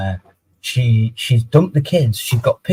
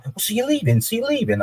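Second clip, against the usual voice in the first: high, softer whiny mock voice; 12 semitones higher, 5 dB quieter than usual. Man imitates ex whining as he leaves, high mock whine. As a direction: parrot her put-upon whine up high. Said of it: "'cause she was like".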